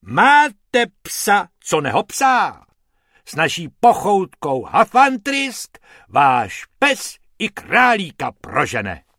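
high, loud commercial slogan; puffed-up ad pitch. Acted. Hippo demonstrating the dog treat advert, puffed-up salesman. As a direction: belt out a bombastic advert. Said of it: "jako neuvěřitelná mičuda a spustil".

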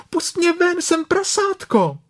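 begging to be let out of the bath; Piglet crying out. A narrator's reading. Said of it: "křičelo prasátko". (prasátko křičí, mnohem výš)

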